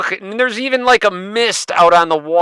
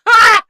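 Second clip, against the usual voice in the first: imitated scream; 7 dB louder than usual. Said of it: "Did you hear him scream?".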